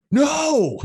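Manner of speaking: shocked shout. Retold or imitated. Friends watching him finish the butter, a shocked high yell. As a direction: shriek it as the bet slips away.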